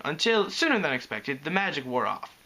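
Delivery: ordinary delivery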